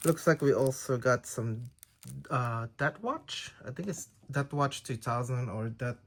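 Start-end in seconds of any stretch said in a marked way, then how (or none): none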